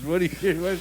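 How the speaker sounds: ordinary talk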